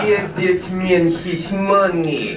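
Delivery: put-on thick Russian accent, drawn out slowly; John Malkovich. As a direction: do a hammed-up slow Russian-accented movie villain. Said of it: "with, uh, John Malkovich".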